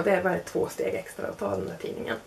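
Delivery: ordinary talk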